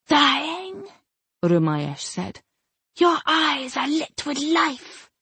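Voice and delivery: high, distant voice of a spirit. Acted. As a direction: speak like a spirit bound in a gem. Rumayesh answers the dying man.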